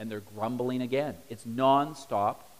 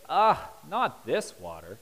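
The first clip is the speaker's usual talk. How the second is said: fussy complaint; 3 semitones lower than usual. Retold or imitated. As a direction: sound like a spoiled diner. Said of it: "and they say".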